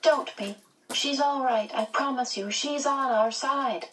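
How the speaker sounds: put-on high-pitched child, gently insistent; young brother promising it's safe; small boy's high voice, slowing to finish; reassuring little boy. A narrator's reading.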